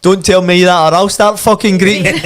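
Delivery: put-on loud, booming Yorkshire accent; Mick McCarthy. Ex-Celtic captain reacts to the wife, booming Yorkshire accent. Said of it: "that big booming Yorkshire accent".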